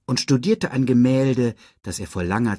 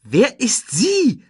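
slow, very high, loud question; squeaky sidekick insisting on an answer; demanding Timon voice; 7 dB louder, slower than usual. A narrator's reading.

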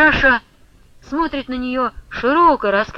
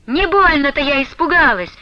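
raised, higher, defiant cry; shouting; 6 semitones higher, 4 dB louder than usual. A narrator's reading. Ustinya defying Flyonushka, shouting at full throat. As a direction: yell that you are not scared. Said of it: "во всё горло продолжала кричать".

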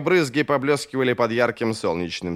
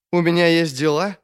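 higher question, trying for authority; casually commanding; 4 semitones higher, 3 dB louder than usual. A narrator's reading. New king asks about his business, trying to sound commanding. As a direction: attempt an offhand, regal tone. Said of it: "стараясь говорить непринуждённо и повелительно".

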